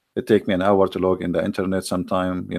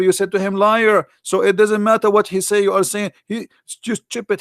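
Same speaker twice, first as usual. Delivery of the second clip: much higher-pitched, exasperated mock scream; imitated angry rant; 12 semitones higher than usual. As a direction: scold your own side in frustration. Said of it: "he screamed at them".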